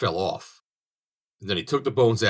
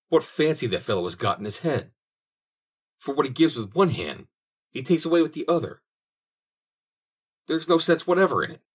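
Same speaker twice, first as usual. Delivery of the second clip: rising higher in irritated disbelief; vexed grumbling; 8 semitones higher than usual. Acted. Lustig grumbling to himself about Saint Peter, building vexation. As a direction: let the annoyance climb to a high peak. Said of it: "very much vexed".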